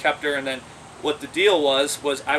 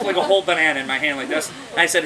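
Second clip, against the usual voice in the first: ordinary talk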